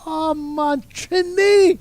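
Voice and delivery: put-on old Jamaican coach hails a young Trinidadian, thrilled; excited Jamaican coach. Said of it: "He said".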